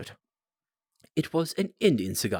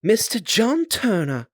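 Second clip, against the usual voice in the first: high, loud, slow call of a name; announcing loudly; 11 semitones higher, 8 dB louder, slower than usual. Acted. Waiter announcing a guest to Holmes, loud and slow. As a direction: call the visitor's name across the room.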